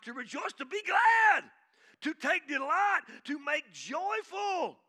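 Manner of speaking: loud, high, shouting preacher; fiery revival shout; full-force preaching. Acted. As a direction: preach it out to the whole church at full volume.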